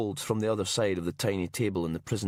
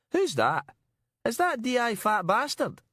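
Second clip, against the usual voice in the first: high, insolent Glaswegian; cheeky high-pitched Glaswegian caller; broad Glaswegian accent. A narrator's reading. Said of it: "Colin Miller's broad Glaswegian accent".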